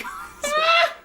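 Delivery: high, loud burst of laughter. Real, not acted. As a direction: crack up out loud. Cracks up.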